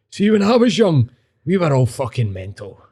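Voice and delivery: high put-on old guy, falling away; old street guy. Old guy outside the bookies boasting of his youth, put-on voice. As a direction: start high, imitating a mad old boaster.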